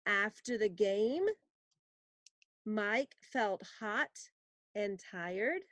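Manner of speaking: very slow, word by word; slow dictation. Shown as a demonstration. Teacher dictating a sentence to copy. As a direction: dictate it slowly. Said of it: "for you to write".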